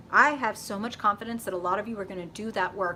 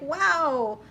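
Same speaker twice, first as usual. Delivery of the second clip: high, loud mock frustration; hammed-up complaint, pitched up and louder; exasperated venting; 7 semitones higher, 5 dB louder than usual. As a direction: whine it like you're fed up with everyone.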